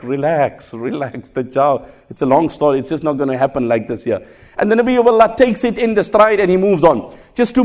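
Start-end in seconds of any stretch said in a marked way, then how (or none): 0.0-0.7 soft, soothing relax; calming. Retold or imitated. Prophet soothes frightened Sauda, softly calming. 0.7-1.3 almost hushed relax, softer still; softer calming. Retold or imitated. Prophet hushes frightened Sauda, very softly. 1.3-4.2 reassuring. Retold or imitated. Prophet reassures panicked Sauda.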